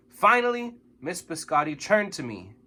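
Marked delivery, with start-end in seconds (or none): none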